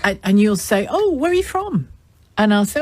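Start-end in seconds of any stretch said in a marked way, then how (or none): 0.9-1.9 much higher, acted curious question; mock-interested "oh", pitched well up. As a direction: imitate someone brightly asking a newcomer. Acts out friendly curious question.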